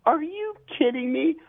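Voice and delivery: mock stunned What; incredulous friend. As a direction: squeal out the disbelief. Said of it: "My friend was like".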